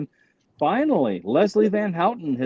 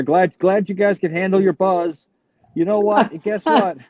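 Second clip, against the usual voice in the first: high-pitched comedy routine, climbing higher as it goes; Sam Kinison; 4 semitones higher than usual. Retold or imitated. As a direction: imitate a stand-up's rant, voice rising steadily. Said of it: "Sam Kinnison's routine about the Manson killers".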